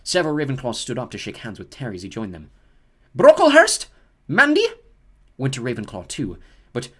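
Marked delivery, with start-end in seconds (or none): 3.2-4.7 loud, high name call. Acted. McGonagall calls a pupil forward, loud and high. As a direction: call the name across the hall. Reading names to the hall.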